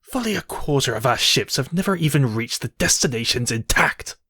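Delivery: normal talking voice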